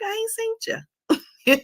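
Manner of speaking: lightly playful country accent, pitched high. Shown as a demonstration. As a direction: slip into a country voice. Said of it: "In my country voice".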